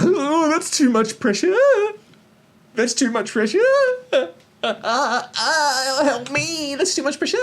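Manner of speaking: high, whiny mock-panicked squeal; mocking the comedian. Critic mimicking the comedian's first words on stage, high mocking whine. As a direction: squeak it out like a helpless wimp. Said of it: "The first thing you say when you come out".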